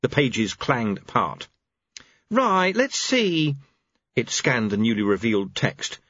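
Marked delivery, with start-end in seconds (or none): 2.3-3.5 slow, higher angel voice; deliberate. Acted. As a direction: draw it out while peering at a page. Angel reading the book.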